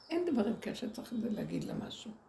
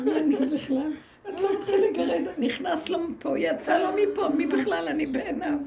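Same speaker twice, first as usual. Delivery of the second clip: louder and higher, laughing through the words; incredulous laughing retelling; 7 semitones higher, 7 dB louder than usual. Real, not acted. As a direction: laugh out the indignant retort. Retelling her outraged reply, laughing.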